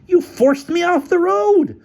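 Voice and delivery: high, loud, drawn-out charge; outraged, stretched-out complaint; angry accusation. Acted. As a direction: accuse him at the top of your range. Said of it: "accused Featherstone".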